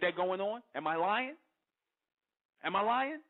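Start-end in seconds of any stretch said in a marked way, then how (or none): 2.6-3.2 higher and louder, pressing the point; demanding rhetorical repeat; insistent repeat. Real, not acted. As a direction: push the question again, daring them to deny it. Rhetorical question repeated for emphasis.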